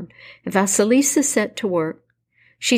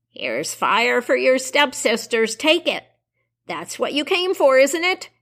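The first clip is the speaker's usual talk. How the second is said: put-on higher, taunting old witch; 5 semitones higher than usual. Baba Yaga hands over skull.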